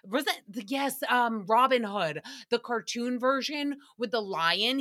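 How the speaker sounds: normal talking voice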